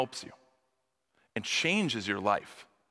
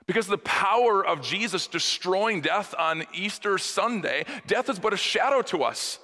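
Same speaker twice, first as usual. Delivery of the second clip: usual speaking voice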